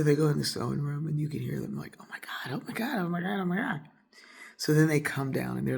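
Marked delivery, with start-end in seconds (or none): 2.0-3.8 light, high-pitched mock gushing; imitated amazement. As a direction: imitate friends gushing from another room. Friends overheard marvelling in sewing room.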